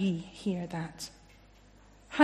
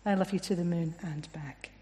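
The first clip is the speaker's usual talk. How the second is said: a gentle hush, not a true whisper; soft whisper; 3 semitones lower, 7 dB quieter than usual. Retold or imitated. A parent to a sleepy child, tucking him into bed, softly murmured. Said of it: "and whispers in his ear".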